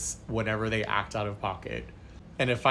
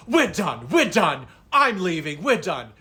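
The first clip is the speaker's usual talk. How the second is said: indignant walkout; 4 semitones higher, 4 dB louder than usual. Retold or imitated. Couple storms out angrily.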